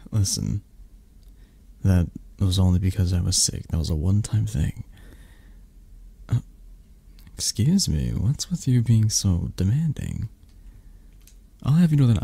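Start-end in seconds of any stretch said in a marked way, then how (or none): none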